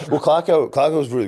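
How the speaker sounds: laughing. Real, not acted. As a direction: laugh it out before answering. Asked about Clarko's response.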